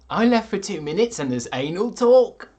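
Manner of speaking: loud, high-pitched mock shock; mock outraged exclamation. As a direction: exclaim it in hammed-up disbelief. Acts out a viewer's shocked comment.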